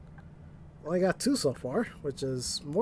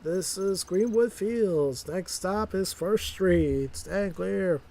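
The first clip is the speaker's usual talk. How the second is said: higher, slow, measured stop announcement; subway announcer; 3 semitones higher, much slower than usual. Acted. Driver announcing stops like a subway conductor, slow and high. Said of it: "Speaking like I'm in the subway".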